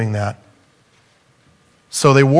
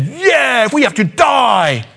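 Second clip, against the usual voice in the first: eager shout; 10 semitones higher, slower than usual. Retold or imitated. Disciples cheer behind Peter.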